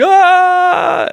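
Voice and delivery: put-on high, loud group scream of scared boys. Boys running back to camp in the dark, screaming. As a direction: let out a panicked boyish shriek. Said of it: "they're all screaming".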